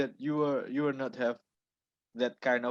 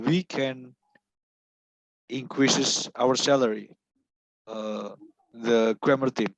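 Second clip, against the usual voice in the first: louder, projecting clearly; speaking up; 4 dB louder than usual. Real, not acted. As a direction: raise your voice so all can hear. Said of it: "can you repeat that?".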